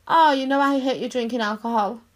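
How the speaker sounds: ordinary talk, nothing marked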